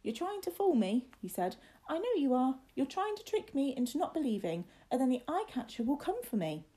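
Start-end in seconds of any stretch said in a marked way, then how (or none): 0.0-1.1 put-on high-pitched young boy's voice; high, protesting little boy; William, scared and disbelieving. A narrator's reading. 1.9-6.7 put-on high-pitched young boy's voice; high, protesting little boy; William, scared and disbelieving. A narrator's reading.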